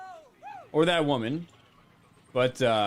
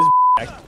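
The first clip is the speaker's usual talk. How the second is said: sudden high squealing laugh; shrieking laugh; 8 dB louder than usual. Real, not acted. Streamer watching a flop clip, high shriek of laughter. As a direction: burst out in a shrill cackle.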